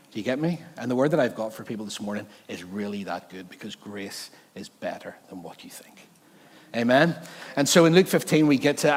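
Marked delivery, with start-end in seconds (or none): 1.5-6.1 whispered. Real, not acted. Preacher whispering his key point to the church, hushed.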